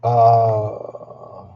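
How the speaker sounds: a big yawn through the word. Real, not acted. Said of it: "when I start to yawn".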